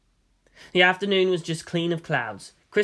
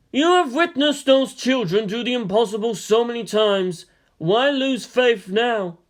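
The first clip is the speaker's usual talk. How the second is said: higher, louder otherworldly voice, slowing at the end; ghostly voice; 6 semitones higher, 4 dB louder than usual. Acted. Dead strategist's ghost answering the colonel, high eerie voice. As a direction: speak as a calm ghost rebuking.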